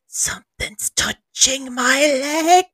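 slow, high, creeped-out imitation; drawn-out, hammed-up dread; mock frightened. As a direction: mimic a man frozen with fright. Mimics frightened skeptic on stairs.

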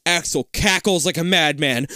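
normal talking voice

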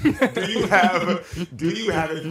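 slow question through laughter; laughing. Real, not acted. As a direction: laugh while asking it. Laughing at himself, slowed.

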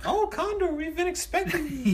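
mock receptionist. Receptionist greeting the expected new hire, in mock falsetto. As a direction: coo a welcome in falsetto.

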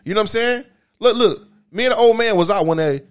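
ordinary delivery

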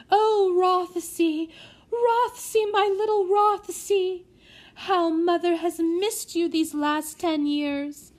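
high, slow, tearful lady's voice; weeping noblewoman clutching her lost son; blubbering. Acted. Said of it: "blubbered Lady Elvira".

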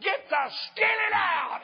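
high, full-voiced holler across the yard; hollering back. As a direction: call back loudly to the house, stretching the words. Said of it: "I hollered back".

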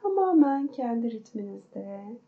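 guided meditation. Acted. Counts breaths in meditation.

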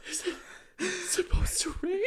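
gasping, high-pitched mock-terrified wail; exaggerated fear. Shown as a demonstration. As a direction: gasp first, then squeal it loud in fake tearful panic. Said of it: "there was enough fear in my voice".

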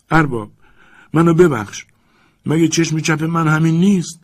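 high, loud terrified pleading; frightened cry. A narrator's reading. Servant pleads with angry master, frightened cry. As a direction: beg for mercy in panic. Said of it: "ژوپیتر هراسان ... فریاد زد".

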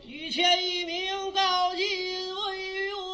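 high, very slow drawn-out herald cry; servant's announcing call. Pretend. Servant announces the guest to the master, drawn-out high call. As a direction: stretch every syllable like a house servant announcing a guest. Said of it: "给您喊一嗓子啊".

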